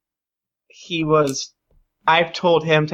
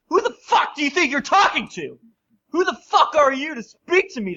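outraged crime boss; 12 semitones higher than usual. Acted. Boss dressing down his insolent lapdog, outraged. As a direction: pitch leaps up in indignation at an insolent underling. Acted quarrel, boss berates underling.

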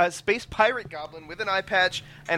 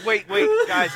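high, wailing protest; whiny plea; 3 dB louder than usual. Real, not acted. Confused player begs to understand.

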